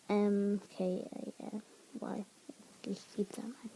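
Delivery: trailing into a near whisper; mumbling. Real, not acted. Narrator spells out the word to themself, mumbling. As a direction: mumble an aside under your breath. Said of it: "Uh, me mumbling".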